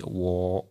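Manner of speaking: "wo" held long on one level pitch; flat first tone. Shown as a demonstration. Teacher showing the first Mandarin tone, "wo" held flat. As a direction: say "wo" evenly, with no rise or fall. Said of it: "il est plat".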